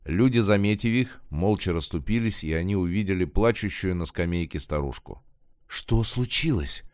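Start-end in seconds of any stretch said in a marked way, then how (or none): 5.7-6.8 restrained cry. A narrator's reading. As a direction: cry out in alarm but keep the volume down. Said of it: "крикнула Зинаида".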